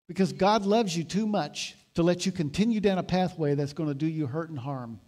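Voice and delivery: ordinary talk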